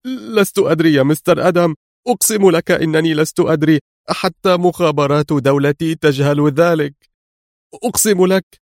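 high-pitched desperate pleading; breaking down. A narrator's reading. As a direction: swear it in helpless desperation. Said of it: "هتف جولييت في انهيار". (توسل منهار)

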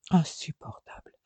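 breathy muttered exasperation; exasperated whisper; whispered aside. Acted. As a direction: sigh it under the breath.

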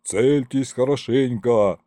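ordinary delivery, nothing marked

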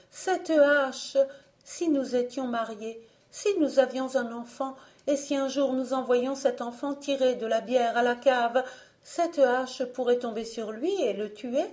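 jeune fille angoissée, voix plus aiguë; elle détaille le malheur; Alice. A narrator's reading. Alice explique à Jean. (Alice explique à Jean, voix plus aiguë)

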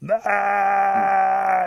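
high, loud, long held cry; mock hollering wail. A narrator's reading. Rabbit still hollering in the bushes, wailing loudly. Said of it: "He keeps hollering".

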